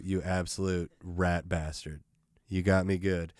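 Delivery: normal talking voice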